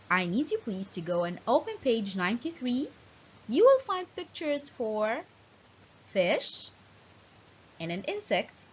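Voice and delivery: ordinary talk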